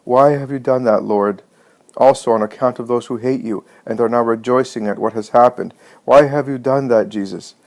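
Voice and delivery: usual speaking voice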